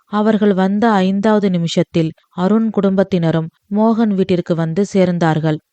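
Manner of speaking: usual speaking voice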